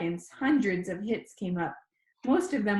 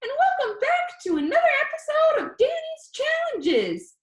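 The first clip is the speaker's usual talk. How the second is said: high, squeaky, cheerful greeting; high squeaky boy; 5 dB louder than usual. Acted. Danny greets his YouTube viewers in a high squeaky voice. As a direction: chirp it out like an excited kid. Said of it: "His voice was high and squeaky".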